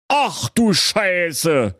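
high, very slow exclamation; king's stretched-out shocked oath; dismayed. A narrator's reading. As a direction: draw the curse out in dismay. King learns dragon took daughter.

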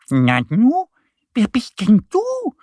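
high-pitched puzzled little duck; surprised high duck voice; Annie. A narrator's reading.

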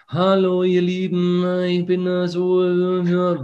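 high, hesitant influencer hello into the phone; awkward influencer greeting. Retold or imitated. Influencer starting a phone video, high and stumbling. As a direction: fumble the opening like a vlogger who can't get going. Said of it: "dann hebt man das Handy, schaut rein".

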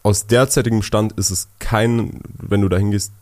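usual speaking voice

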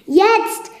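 high, loud battle signal; sharp rallying cry; calling out. A narrator's reading. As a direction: shout the order to attack. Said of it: "rief Lumière".

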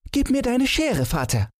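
put-on high little boy's voice; a small boy making a hard decision; reluctantly resolved child. A narrator's reading. Son asks father for scissors, high reluctant child's voice. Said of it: "sagte Thomas mit schwerem Entschluss".

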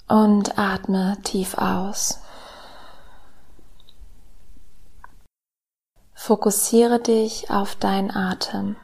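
slow, soft meditation voice with whispered lines; calm guided meditation, hushed and slow; soft spa whisper. Acted.

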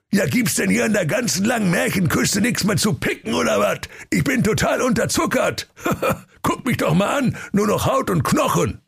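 hohe, gepresste Königsstimme; seufzender König. Acted. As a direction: verfressener König jammert hoch. (jammernder dicker König)

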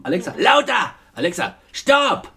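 lightly imitated bossy orders to a device; a hint of barking commands at a dog. Mother mimics daughter ordering Alexa around, mildly bossy. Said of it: "Du kommandierst die voll rum".

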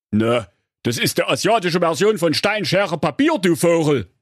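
high know-it-all voice; smart-alecky. Acted. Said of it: "neunmal klug".

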